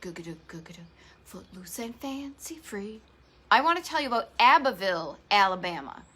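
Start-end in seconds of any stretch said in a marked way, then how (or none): 0.0-3.0 hushed whispered Muppet line, slowed down; whispered Muppet quote. Retold or imitated. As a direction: whisper it like a secret singsong. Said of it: "as the Muppets would say".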